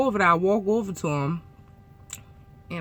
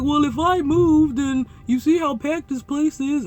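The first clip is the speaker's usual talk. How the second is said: high, drawn-out imitation of the driver; put-on driver voice; 7 semitones higher than usual. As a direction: explain it in his silly high voice. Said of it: "And I'm talking like that".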